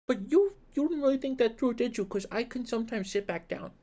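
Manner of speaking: doting voice for a pet. Real, not acted. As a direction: coo at the grumpy cat teasingly. Baby talk to the cat.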